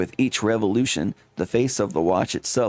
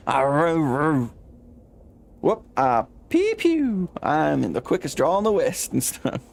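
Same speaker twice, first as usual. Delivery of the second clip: high mock gun noises, pew pew; cowboy gunslinger; 4 semitones higher than usual. [name] shows off as a gunslinger, hammed-up high. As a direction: ham up a quickdraw.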